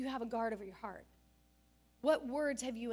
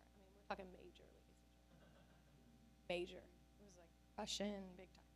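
hushed aside; 5 semitones lower, 16 dB quieter, faster than usual. Real, not acted. Aside to congregation.

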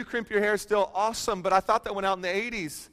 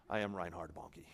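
low, hushed mock awe at yourself; quietly imitating inflated pride; mock self-importance; 12 semitones lower, 15 dB quieter than usual. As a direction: say it low and soft, as a private boast. Mock grand thought to himself.

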